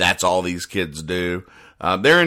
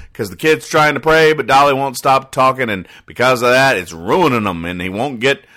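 ordinary delivery

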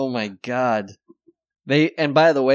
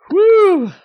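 loud whoop; 10 dB louder than usual. Real, not acted. Cheering whoop.

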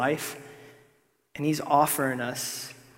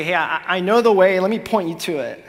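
breezy, louder mock of a know-it-all guide; mock casual guide; 4 semitones higher, 10 dB louder than usual. Preacher mocking a casual guide to the congregation. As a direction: lightly play a chummy guide offering tips. Mock voicing what Jesus doesn't say.

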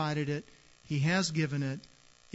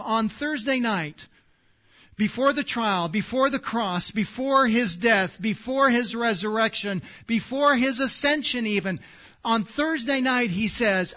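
louder, higher preaching, hammering each phrase; full-force preaching; 6 semitones higher, 7 dB louder than usual. Acted. Pastor urging his congregation to take courage, loud and high preaching.

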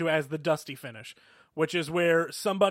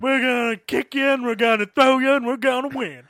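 wrestling promo; 6 semitones higher, 6 dB louder than usual. Retold or imitated. Mimicking the Road Warriors promo.

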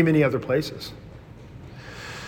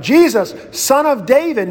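raised, higher call to Jesus; crying out; 7 semitones higher than usual. Retold or imitated. Blind beggar calling out to Jesus, a raised plea. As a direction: call out over the crowd, pleading. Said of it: "the blind guy cries out".

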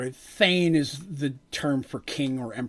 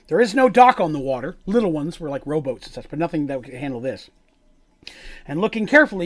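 game master narration; 4 dB louder than usual. Acted. Reads scene setting aloud.

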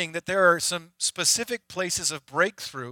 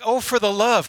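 exasperated; 6 semitones higher, 7 dB louder than usual. Retold or imitated. Exasperated at repeatedly cleaning drains.

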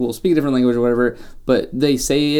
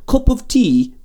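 high, louder mock British accent; terrible British impression, pitched up; bad British accent; 11 semitones higher, 3 dB louder, slower than usual. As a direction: put on a hammy British accent. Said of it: "the worst British accent".

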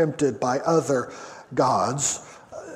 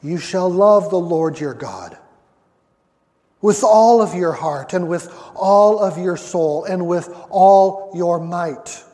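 scripture reading; 3 semitones higher, 7 dB louder than usual. Acted. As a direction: lay out the verse with weight. Reads verse five aloud.

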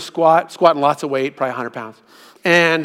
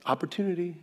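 soft divine nudge; 12 dB quieter than usual. Retold or imitated. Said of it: "the Lord was like".

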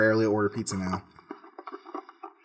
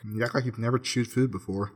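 normal talking voice

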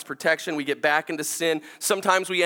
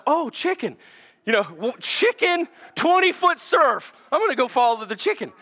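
high, carefree kid distracted by a chicken; excited child; 7 semitones higher than usual. Son talking to himself about chasing a chicken, high and excited. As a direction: pitch it up, a little boy thrilled and easily sidetracked.

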